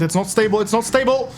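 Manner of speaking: panicked call, climbing in pitch; rising alarm. Real, not acted. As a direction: start uneasy, build to a high alarmed cry. Cargo comes loose while driving.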